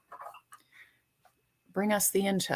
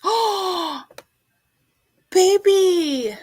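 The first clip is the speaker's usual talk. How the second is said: delighted gasp; 10 semitones higher, 7 dB louder, much slower than usual. Real, not acted. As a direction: gasp with joy at the news. Reacts to news of early baby.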